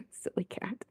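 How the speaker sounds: hushed whisper to the cat. Real, not acted. Streamer murmuring to the cat on her lap, whispered. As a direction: breathe it out unvoiced, fondly.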